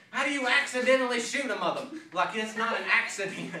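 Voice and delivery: loud, high-pitched mock indignation; retold outraged protest. Wounded man arguing with the shooter, retold outraged protest. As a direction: fire back incredulously, half laughing by the end.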